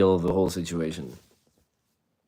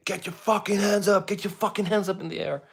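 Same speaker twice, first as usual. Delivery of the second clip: much higher barked police command through a megaphone; police megaphone order; 12 semitones higher than usual. Retold or imitated. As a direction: play the cop bellowing orders over a loudspeaker. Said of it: "someone with a megaphone saying".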